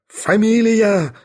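high, drawn-out roar. A narrator's reading. Bormental demands her surname from Sharikov, roaring. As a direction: roar the word out slowly at high pitch. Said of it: "заревел он".

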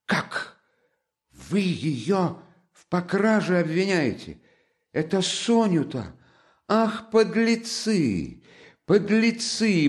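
high, slow outraged cry. A narrator's reading. Katerina denounces the accusers of Sonya, high outrage. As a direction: hurl it at them in fury.

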